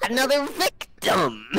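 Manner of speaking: high, cackling mad wizard cry; gleeful villain greeting a new victim. Acted. Wizard gloating at the captured player. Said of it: "this wizard that says".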